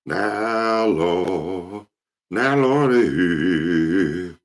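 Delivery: drawn-out preacher cry, high and loud on Lord; praying deacon. Retold or imitated. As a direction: wail it like an old deacon at the altar. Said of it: "the way he sounded".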